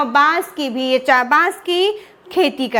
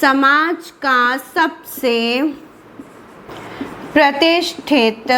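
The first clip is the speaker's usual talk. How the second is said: slow dictation; much slower than usual. Shown as a demonstration. Teacher dictates key phrase slowly.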